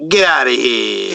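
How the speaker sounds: put-on playful Italian accent, lightly done. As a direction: toss it off like a Italian pizza man. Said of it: "I like your Italian, uh, accent there".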